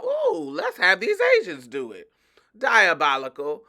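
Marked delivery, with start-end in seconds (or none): 0.0-2.1 high, sly plotting voice; lightly voiced sly planner; mock boss voice. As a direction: play the scheming boss, pleased with himself. Mocking the bosses' scheme.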